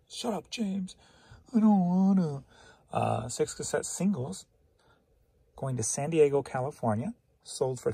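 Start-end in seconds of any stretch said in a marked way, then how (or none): 0.1-2.4 high, whiny back-and-forth; mock self-argument. Telling himself off, high bratty mock quarrel. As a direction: sass yourself like a bratty kid.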